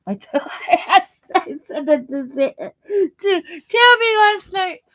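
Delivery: laughing through the words; giggling, pitch climbing high. Real, not acted. Laughing at herself.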